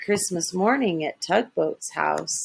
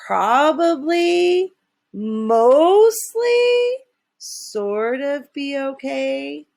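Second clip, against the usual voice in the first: unsure hedging; 7 semitones higher, 3 dB louder, much slower than usual. Acted. Hesitant hedging of plan.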